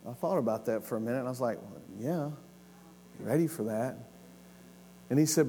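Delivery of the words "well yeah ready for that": soft, low, tentative answer; hesitant reply. Retold or imitated. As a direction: answer quietly, as if not quite sure. Retells his own hesitant answer to God.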